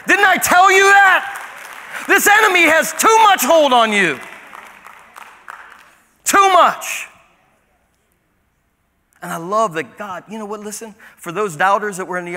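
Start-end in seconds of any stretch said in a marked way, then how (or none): 0.1-7.1 high, slow, thundering rebuke; rebuking shout. Acted. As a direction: blast it at the doubters. Jesus rebuking doubters.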